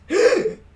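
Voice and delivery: sharp high breathy gasp. Acted. Raven asked to the concert by Draco, sharp high gasp. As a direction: suck in air, startled. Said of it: "I gasped".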